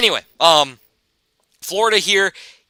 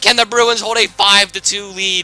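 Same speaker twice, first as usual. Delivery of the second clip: ordinary talk, nothing marked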